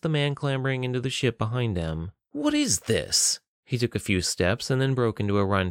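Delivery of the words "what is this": high, drawn-out challenge; alarmed demand. A narrator's reading. Guard spots man climbing into the ship, alarmed demand. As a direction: demand it high and slow, startled.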